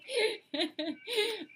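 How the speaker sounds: dissolves into high laughter at the accent try; laughing instead of Scottish accent. Real, not acted. Reader admitting to viewers she can't do Kyle's Scottish accent, laughing. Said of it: "I can try".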